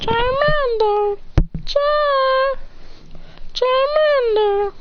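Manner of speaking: high, slow, stretched creature cry; Charmander impression. Shown as a demonstration. Kid showing off his Charmander cry, high and slow. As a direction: squeak out the name like the little fire Pokemon. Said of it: "I do the best Charmander impression ever".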